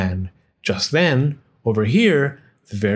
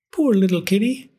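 put-on higher, tender old woman voice; pitying old woman; 8 semitones higher than usual. A narrator's reading. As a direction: coo it like a kind old woman. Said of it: "said the very old woman".

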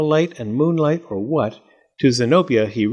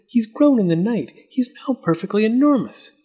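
a hint of wonder, pitched higher; lightly voiced amazed Fletcher; 10 semitones higher than usual. A narrator's reading. Fletcher tells Zenobia the moth grew overnight, lightly amazed. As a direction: Fletcher marvelling at the moth's size.

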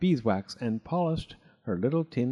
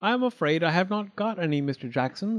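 higher, prim mouse voice; higher prim little mouse; fussy little mouse; 6 semitones higher than usual. Acted. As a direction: politely refuse the greedy guest. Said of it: "said Missus Tittlemouse".

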